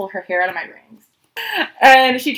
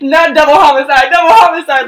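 loud, higher retold yell; laughing shout; 4 semitones higher, 8 dB louder than usual. Yelling at Caleb not to commit a double homicide.

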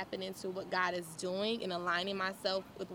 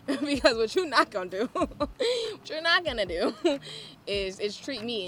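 loud, raised words broken by laughs; laughing defiance; 4 semitones higher, 4 dB louder than usual. Real, not acted. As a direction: warn them while cracking up. Laughing through her defiant declaration.

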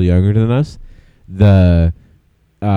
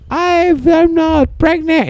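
high, drawn-out mock wail; mock Irish girl; slower than usual. Friend re-enacts the movie's Irish girl, high mock outburst. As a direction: squeal it slowly as an over-dramatic impression. Acting out Irish girl's ending line.

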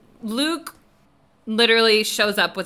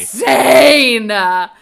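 loud outburst; 2 semitones higher, 9 dB louder, much slower than usual. Real, not acted. Loud reaction about her mom.

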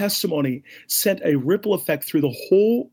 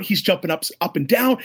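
usual speaking voice

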